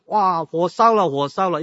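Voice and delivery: loud slowed alarm cry, high; shouting fire. Retold or imitated. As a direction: call out the fire in panic. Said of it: "一直在那边喊".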